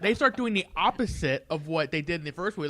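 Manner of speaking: usual speaking voice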